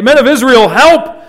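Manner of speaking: high, loud cry of alarm; crying for help. Retold or imitated. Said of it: "They're crying out".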